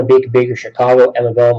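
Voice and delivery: normal talking voice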